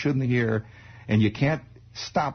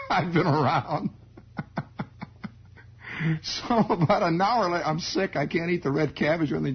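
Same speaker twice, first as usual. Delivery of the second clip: ordinary delivery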